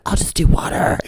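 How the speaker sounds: meek half-whispered answer; mock timid whisper; timid little reply. Retelling timid reply at orgy.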